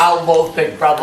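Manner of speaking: put-on Yorkshire accent. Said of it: "That was my Northern Yorkshire accent".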